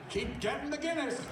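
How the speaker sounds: high, lightly put-on director's verdict; imitating Kubrick. Kubrick telling Malcolm to keep supplying Guinness, lightly mocking. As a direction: say it pleased, pitched well up. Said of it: "He goes".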